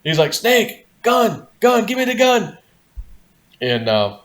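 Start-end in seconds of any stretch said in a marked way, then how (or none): 0.3-2.6 high, loud panicked call for the gun; urgent yell. Retold or imitated. Friend yelling at the group about the rattlesnake, high panicked shout.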